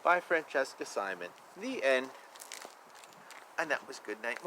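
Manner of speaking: usual speaking voice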